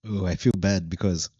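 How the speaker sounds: ordinary talk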